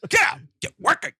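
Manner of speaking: loud, high barked orders; mock bossy shout. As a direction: play the boss yelling at the crew. Riffing as boss ordering work.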